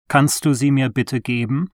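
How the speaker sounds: ordinary delivery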